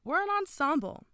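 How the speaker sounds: high, loud shout to break up a quarrel; Jessica shouting. A narrator's reading. Girl telling the arguing group to stop, shouting. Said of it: "Jessica shouted".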